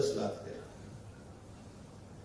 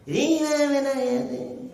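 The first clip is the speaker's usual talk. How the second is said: dismayed daughter; 11 semitones higher, 4 dB louder than usual. Acted. Daughter hears mother cooked brinjal, whining in dismay. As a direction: wail the dish's name in mock dismay.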